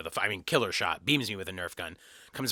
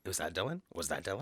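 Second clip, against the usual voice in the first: quiet wondering; 5 semitones lower, 10 dB quieter than usual. Retold or imitated. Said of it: "every smell I smelled was like".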